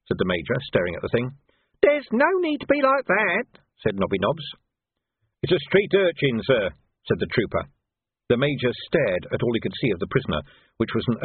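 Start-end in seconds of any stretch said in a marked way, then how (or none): 1.8-3.6 put-on much higher, slow, indignant boy's voice; a wheedling gutter lad taking offence; cheeky street urchin Nobby, aggrieved. Nobby's voice held through scene. 5.4-6.7 a hint of a plain soldier; dutiful trooper. Trooper reports to major.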